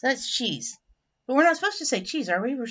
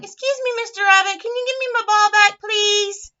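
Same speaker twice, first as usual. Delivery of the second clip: high, louder polite plea; storybook otter asking politely; imagined otter request; 9 semitones higher, 6 dB louder than usual. Retold or imitated. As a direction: call out sweetly in a high character voice. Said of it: "he's probably gonna say something like".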